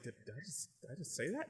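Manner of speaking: hushed, stammered disbelief; inner thought. Acted. In his head after confronting them, hushed disbelief. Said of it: "in his head".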